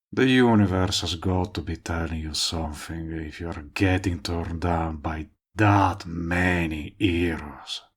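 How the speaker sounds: normal talking voice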